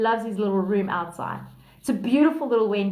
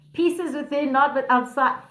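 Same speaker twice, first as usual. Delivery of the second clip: ordinary talk, nothing marked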